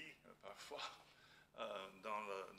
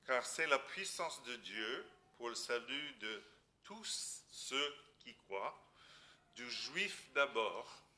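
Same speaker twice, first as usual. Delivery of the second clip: slow, solemn Bible reading; scripture reading; 6 dB louder than usual. Acted. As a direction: read the verse slowly and gravely. Reads a Bible verse aloud.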